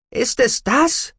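high, loud, drawn-out challenge; called out. A narrator's reading. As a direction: cry it out indignantly, stretching the words. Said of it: "rief sie".

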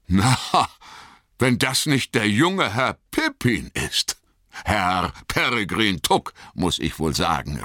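a farmer breaking into a grin; pleased farmer. Acted. Farmer recognises Pippin.

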